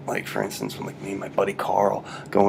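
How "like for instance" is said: usual speaking voice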